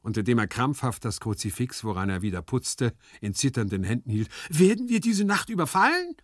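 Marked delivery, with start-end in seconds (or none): 4.5-6.1 high, frightened question; alarmed, high-pitched voice; trembling alarm. Acted. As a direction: ask in panic at an attack. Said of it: "mit zitternder Stimme".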